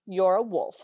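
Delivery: calm, matter-of-fact correction; a measured, composed quote; calm statement. Retold or imitated. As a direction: state it evenly, without alarm. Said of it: "She states rather calmly".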